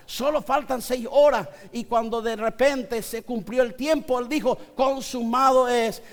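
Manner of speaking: preacher proclaiming Jesus' victory with rising fervour; fervent preaching. Acted. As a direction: build it to a joyful climax.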